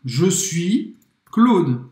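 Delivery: "Je suis Claude" drawn out; slow model. Shown as a demonstration. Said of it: "Três formas de falar". (frase-modelo bem lenta)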